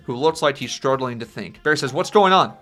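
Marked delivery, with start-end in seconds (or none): 1.9-2.5 raised voice, higher and louder; urgent call. Acted. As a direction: call it out over the rush. Calling out while running at speed.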